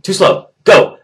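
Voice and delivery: high, loud, impatient order; barked coach command. Retold or imitated. Coach skipping the slow captain, shouted impatiently. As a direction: cut them off like a drill coach.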